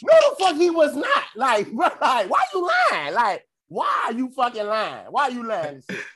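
high, loud disbelieving rant; incredulous outburst. Real, not acted. Son calling his mom out for lying, incredulous and high. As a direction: call out the lie, half laughing. Son disputing mom's claim.

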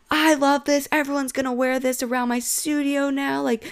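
high-pitched gush over a new favorite; excited mock gushing; gushing hype. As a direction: imitate someone hyping it up. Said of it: "she was hyping it up".

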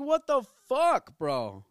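loud, high, drawn-out disbelief; retold shocked outburst. Boyfriend reacting to older dudes' messages, shocked outburst. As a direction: exclaim it loudly, stretching the words in outrage.

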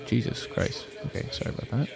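muttered under breath, unvoiced; whispered aside. Real, not acted. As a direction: drop to a whisper. Annoyed at mic, aside.

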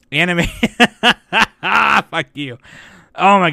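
cracking up mid-sentence at himself; laughing through tears. Real, not acted. Podcaster laughing off his own crying before the news, laughing.

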